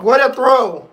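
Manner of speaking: loud, high excited yell; excited shout. Real, not acted. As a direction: shout it out in pure joy. Reacting to game-winning throw.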